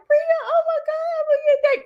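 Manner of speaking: hammed-up crying. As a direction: imitate yourself wailing after your child.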